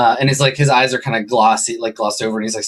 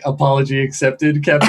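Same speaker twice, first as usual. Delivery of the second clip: smug villain quote. Acted. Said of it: "this is the part where Grinch is like".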